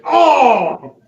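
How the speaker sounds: sudden pained yelp; loud surprised shout. Acted. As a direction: yell as it hits. Turkey leg hits Mayhem.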